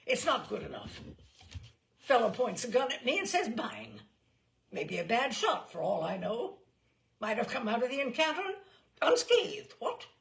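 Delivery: loud, indignant bluster rising. A narrator's reading. General complains to the umpire, blustering loudly. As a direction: huff and puff, outraged.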